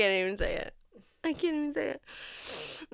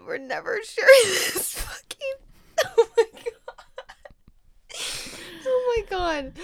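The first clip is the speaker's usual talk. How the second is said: snotty crying; 11 semitones higher, 5 dB louder than usual. Real, not acted. Overwhelmed, crying while laughing.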